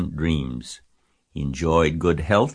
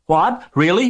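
very high, loud amazed cry; 13 semitones higher, 7 dB louder than usual. A narrator's reading. Pig hears spider's promise, high astonished cry. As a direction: squeal with sudden hope. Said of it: "cried Wilbur".

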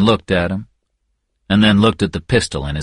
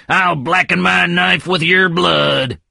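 high-pitched slow battle cry; shouting; 9 semitones higher, slower than usual. Acted. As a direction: threaten him with a yell.

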